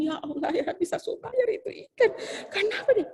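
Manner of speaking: can't believe she returned the fish; laughing disbelief. Real, not acted. Teacher recalls the fish sent back, laughing in disbelief.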